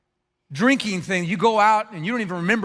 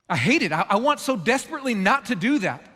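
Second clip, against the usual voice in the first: normal talking voice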